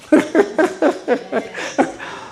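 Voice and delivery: a burst of high airy laughter; real unvoiced laughter. Real, not acted. Laugh after joke.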